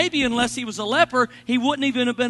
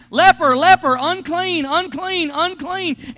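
raised, higher, projected warning cry; mock yelled warning; 6 semitones higher, 7 dB louder, slower than usual. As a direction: call it out as a forced warning. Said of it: "had been forced to yell".

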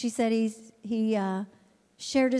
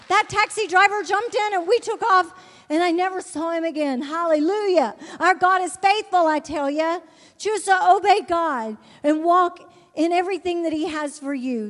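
high, loud, fervent testimony; preaching at full force; 7 semitones higher, 6 dB louder than usual. Acted. Preacher testifying to the church, loud and fervent. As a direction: proclaim it to the congregation.

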